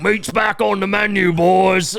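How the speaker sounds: high, loud hammed-up orc growl; mock Uruk-hai. Mocking Scottish people as Uruk-hai, high and loud. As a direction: snarl it like a hungry Lord of the Rings orc. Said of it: "They're just like".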